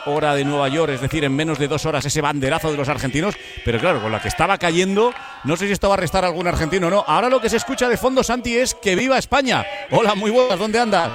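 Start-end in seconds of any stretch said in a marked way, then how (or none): 5.9-11.1 high, lively host greeting; excited. Real, not acted. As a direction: call out to the reporter over the noise. Greeting reporter amid chanting crowd.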